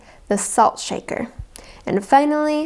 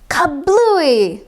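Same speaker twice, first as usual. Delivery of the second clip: loud kablooey; 6 semitones higher, 4 dB louder than usual. A narrator's reading. Water balloon bursts.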